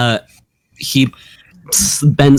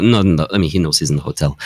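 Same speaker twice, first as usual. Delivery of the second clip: ordinary delivery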